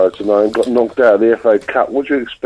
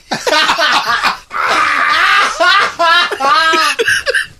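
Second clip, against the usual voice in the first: howling laugh. Real, not acted. Drunk guest cracks up.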